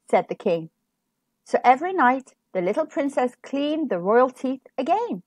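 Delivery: usual speaking voice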